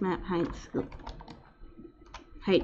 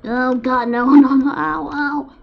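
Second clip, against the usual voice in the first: loud, high panicked cries; panicked yelling; 6 semitones higher, 9 dB louder, slower than usual. Real, not acted. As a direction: yelp in sudden alarm. Panicking at game disaster.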